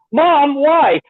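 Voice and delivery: put-on high whiny teen plea; whiny daughter. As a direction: whine it like a fed-up daughter. Daughter voiced in retold dub scene.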